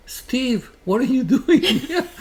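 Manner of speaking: high-pitched, delighted disbelief breaking into laughter; amazed recognition. Retold or imitated. Reunited with his friend Father Esteban, laughing in amazement.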